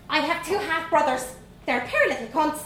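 put-on high, bold Dublin girl voice; young Dublin girl. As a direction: play a cheeky little Dublin girl. Vanessa rude.

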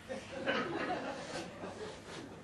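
a quiet breathy giggle; soft airy laugh. Real, not acted. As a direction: let a hushed laugh slip out. Reader laughs at own line.

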